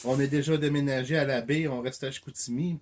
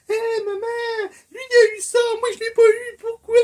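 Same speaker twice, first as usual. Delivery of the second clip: put-on high, loud little kid complaining; whiny child voice; 10 dB louder than usual. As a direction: whine like a jealous child to mom. Child voiced whining to mother.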